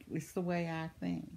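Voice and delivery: soft, reflective repeat; quiet echo. Real, not acted. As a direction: drop the voice and say it again to yourself. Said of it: "But it's the way I think".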